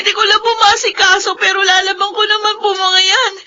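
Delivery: distressed near-crying complaint; tearful protest. Acted. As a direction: beg her grandmother to stop shaming her. Talking back to grandmother.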